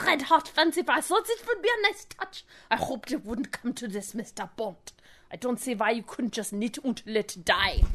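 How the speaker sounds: German accent with throaty R; German-accented villain. Shown as a demonstration. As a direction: do a German mastermind, R from the throat, sinking low and soft. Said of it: "with the back of the throat".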